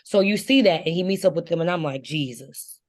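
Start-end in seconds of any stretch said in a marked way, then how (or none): 2.0-2.8 low-key, much softer exclamation; muttered dismay. Retold or imitated. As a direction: say it under your breath. Reaction, first muttered, then exclaimed.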